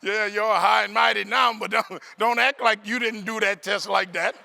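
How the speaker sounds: loud, slow playful ribbing; teasing the crowd. Real, not acted. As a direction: call them out with a grin. Teasing the audience.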